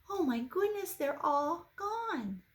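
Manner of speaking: dismayed gasp. Acted. Mama Duck dismayed.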